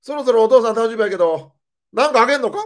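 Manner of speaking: the mom who forgets and asks straight away; mock cheerful mother quizzing; imitating mom. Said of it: "返事必要な会話をずっとしてくんのよ".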